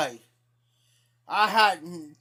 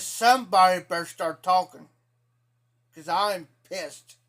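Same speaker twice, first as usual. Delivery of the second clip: angry threat; 4 dB louder than usual. Retold or imitated. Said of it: "I was pissed".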